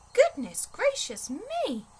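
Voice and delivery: high, slow astonished exclamation; amazed. Acted. Teddy sees only the chimney left, high slow amazement. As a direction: draw it out, wide-eyed and high. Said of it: "said Teddy, amazed".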